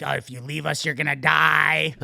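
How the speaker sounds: hammed-up creepy cult voice, stretched threat; mocking cult members. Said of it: "they're like".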